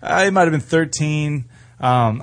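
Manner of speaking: normal talking voice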